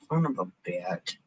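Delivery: low, soft grumbled curse; subdued, low-pitched swearing. Real, not acted. Low curse before bursting into song.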